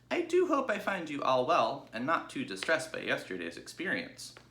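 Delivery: a sweet, well-mannered little pig; polite young pig. Acted. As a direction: Babe greeting the sheep, high and polite. Babe's voice in story.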